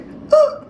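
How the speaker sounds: short, high wheezy gasp; imitated wheeze. Shown as a demonstration. Mother demonstrating her asthmatic child's wheeze, imitated wheezy gasp. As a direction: mimic an asthmatic wheeze on one breath. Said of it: "so I know it sounds like a".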